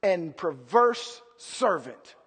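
low, slow, measured condemnation; stern, quietly acted rebuke; master rebuking servant. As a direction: pause heavily between the damning words. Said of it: "You know what he said to him".

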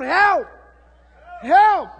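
loud, high cries of help; full-voiced desperate shout. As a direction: throw your hands up and shout it to God. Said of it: "Somebody need to shout".

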